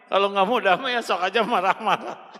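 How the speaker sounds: normal talking voice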